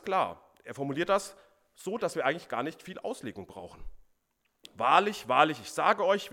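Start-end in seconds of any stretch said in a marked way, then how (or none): 4.7-6.3 solemn proclamation. Acted. Solemn emphatic opening of Jesus' words.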